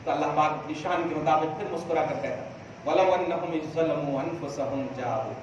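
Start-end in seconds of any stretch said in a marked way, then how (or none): 2.9-5.3 Quran recitation. Real, not acted.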